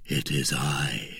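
low, soft and slow; subdued. A narrator's reading. D'Artagnan answers Milady in the dark, low and subdued. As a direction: keep it hushed and low. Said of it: "said D'Artagnan in a subdued voice".

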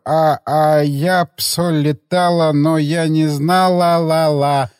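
put-on slow, slurred drunk with drawn-out vowels; drunken babble. Drunk assistant rambling about an attacked community, slurred drunken babble. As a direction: ramble incoherently like a hopeless drunk. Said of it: "На пьяном, на пьяном языке".

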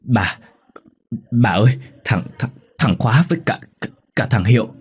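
frightened stammer, repeating words between gaps; acted shock, struggling to get the names out; faint, halting. A narrator's reading. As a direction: gasp it out in broken fragments. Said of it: "thều thào nói ngắt quãng".

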